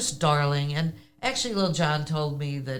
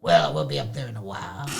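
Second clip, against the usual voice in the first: much lower voice imitating the man on the boat; a far lower impression of the hovering husband; 11 semitones lower, faster than usual. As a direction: drop way down to mimic him. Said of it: "This is how the person really talks".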